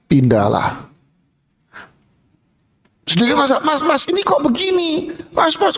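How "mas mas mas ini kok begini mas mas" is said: much higher, nagging fussy voice; whiny complainer. Acted. Diner nagging the waiter again, high and whiny. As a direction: whine at him like a spoiled boss.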